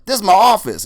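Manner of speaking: put-on high, loud proud old man; Willie Mitchell voice. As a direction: announce your famous guests with swagger. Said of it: "and he's like".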